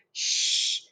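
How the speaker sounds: whispered shh; hush. Acted. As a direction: hush them like a secret is coming. Teacher hushes class mid-story.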